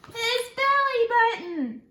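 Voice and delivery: high, loud, slow reveal; big storybook reveal; excited reveal. Acted. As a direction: announce the surprise with delight.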